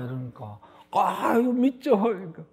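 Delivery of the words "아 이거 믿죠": man clinging to a branch. Retold or imitated. Man hanging from cliff.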